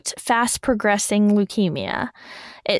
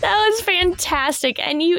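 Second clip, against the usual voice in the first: laughing; 10 semitones higher than usual. Real, not acted. Still laughing after joke.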